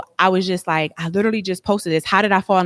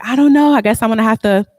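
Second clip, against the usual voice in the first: high, loud, panicked blurt; panicked cry; 5 semitones higher, 7 dB louder than usual. Retold or imitated. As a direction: voice shoots up in alarm over the stalled car. Retold panic over broken car.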